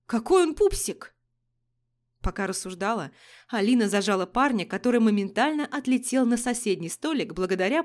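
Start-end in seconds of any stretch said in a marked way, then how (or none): none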